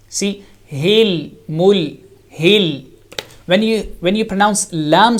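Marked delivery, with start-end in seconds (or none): 0.1-2.8 "hil" and "mul" with the final l held; isolated "hil, mul", each l unreleased; non-bouncing lam. Shown as a demonstration. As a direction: let the tongue stick on the l of "hil" and "mul". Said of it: "try to understand the difference".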